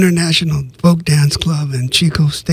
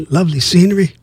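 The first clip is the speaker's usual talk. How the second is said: ordinary delivery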